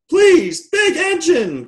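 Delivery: very high, loud toy voices; high-pitched storybook cry for help. A narrator's reading. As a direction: plead loudly in a squeaky high voice. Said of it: "cried all the dolls and toys".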